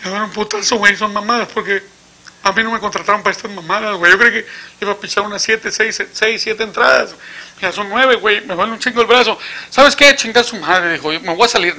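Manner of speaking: high, whiny complaining pitcher; mock tearful whining. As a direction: whine it like a pitcher close to tears. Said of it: "ya estaba llorando". (lloriqueo exagerado, agudo)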